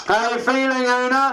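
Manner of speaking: high, eager reporter's question; lightly voiced journalist calling out. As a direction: shout it up from the press pack.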